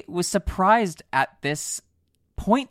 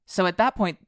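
ordinary talk, nothing marked

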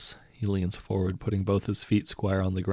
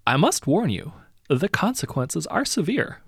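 louder, higher, veiled threat; menacing hero; 8 semitones higher, 4 dB louder than usual. A narrator's reading. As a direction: warn them with a smile.

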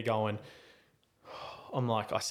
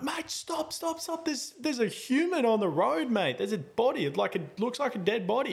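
retold panicked scream; 10 semitones higher than usual. Passenger begging driver to stop for a body, acted panicked yelling. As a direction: plead with the driver to halt, pitched way up. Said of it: "screaming at this guy that doesn't know English".

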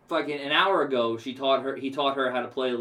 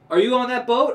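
mock official bark; 12 semitones higher, 9 dB louder than usual. Official voice, retold.

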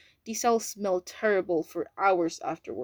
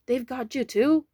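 higher, louder shocked question; shocked shout; 4 semitones higher, 5 dB louder than usual. A narrator's reading. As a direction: blurt it in disbelief. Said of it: "he shouted out".

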